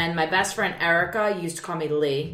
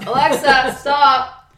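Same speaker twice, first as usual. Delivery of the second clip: shout at Alexa; 8 semitones higher, 10 dB louder, slower than usual. Real, not acted. Yelling at the smart speaker.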